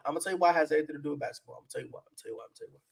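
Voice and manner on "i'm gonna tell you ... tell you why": each repeat much softer, dying away; fading echo. Pretend. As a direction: let it fade out like an echo.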